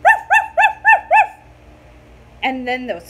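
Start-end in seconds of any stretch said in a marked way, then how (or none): 0.0-1.4 very high, loud yappy barks; loud squeaky puppy yaps; puppy barking. A narrator's reading. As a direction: yap like a little puppy. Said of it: "the puppy went".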